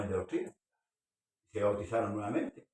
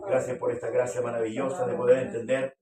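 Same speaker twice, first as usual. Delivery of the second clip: a fervent prayer, slightly louder; closing prayer; 5 dB louder than usual. Acted. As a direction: pray over the congregation at the close. (oración final fervorosa)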